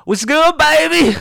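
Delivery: cousin voice. Retold or imitated. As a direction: call out to a stranger on the street. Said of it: "he's like".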